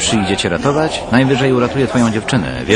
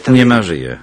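ordinary talk

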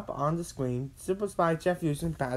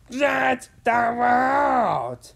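high, loud, long drawn-out proclamation; villain's shout; 10 semitones higher, 9 dB louder, much slower than usual. A narrator's reading. Professor revealing his evil plan, shouting. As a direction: shout it slowly, stretching the last word.

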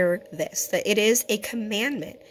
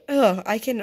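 usual speaking voice

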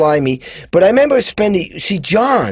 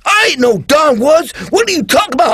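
offended retort; 10 semitones higher than usual. Retold or imitated. Backing singer mistaken for the producer, mock-indignant shout. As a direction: snap back, insulted at the mix-up.

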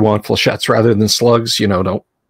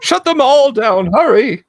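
panicky high voice; 13 semitones higher than usual. Acted. Mock panicked order to robots.

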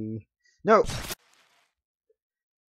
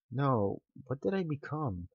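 ordinary talk, nothing marked

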